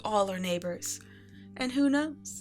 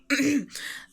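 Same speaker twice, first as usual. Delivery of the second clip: loud, pointed throat clearing; covering throat clear; 5 semitones higher, 5 dB louder than usual. Acted. Empress catching herself saying "I", covering throat clear.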